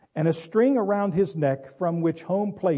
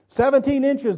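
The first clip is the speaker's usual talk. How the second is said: higher, firm, sure of the answer; a confident chorus answer, pitched up; more confident; 9 semitones higher, 6 dB louder than usual. Retold or imitated. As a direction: say it like you finally know it. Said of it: "sounding more confident".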